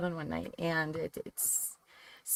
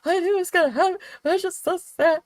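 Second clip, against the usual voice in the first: much higher, louder mock sobbing; imitating sobbing son; 5 dB louder than usual. Mom imitating her son crying over a TV death, mock sobbing. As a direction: play the heartbroken teen mid-cry. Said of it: "he's bawling".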